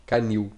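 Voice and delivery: canil with the final syllable nil stressed; canil, hit the end; stress on last syllable. Shown as a demonstration. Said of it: "il est oxytone, c'est-à-dire la syllabe la plus forte, c'est la dernière". (canil, accent sur la dernière syllabe)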